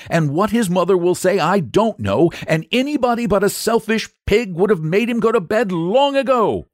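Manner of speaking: high, indignant saint's rebuke; acted righteous telling-off; firm scolding. A narrator's reading. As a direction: scold steadily, building to the final words. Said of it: "said the saint firmly".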